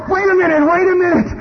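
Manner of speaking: high, hurried wait a minute; alarmed protest. Acted. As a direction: pull back in panic. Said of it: "And you're going".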